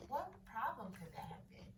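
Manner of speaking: hushed wondering; whisper. Real, not acted. Teacher whispering a wondering question to class. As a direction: whisper the question to pull them in.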